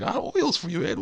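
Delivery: eager, very high falsetto patter; coaxing walrus cook. Retold or imitated. Walrus tempting Crash with bath oils, eager falsetto. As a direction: pile on the offers in a squeaky comic voice. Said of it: "doing the same thing with this walrus".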